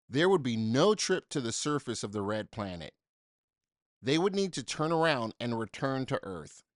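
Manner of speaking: usual speaking voice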